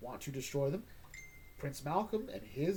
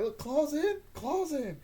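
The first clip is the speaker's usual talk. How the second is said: voiced character; 12 semitones higher, 5 dB louder, slower than usual.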